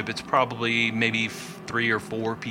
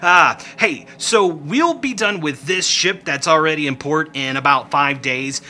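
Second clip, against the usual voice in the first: pushy little trader; 3 semitones higher, 5 dB louder than usual. Acted. Rickety urges the captain to return in five days, loud. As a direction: talk them into coming back later.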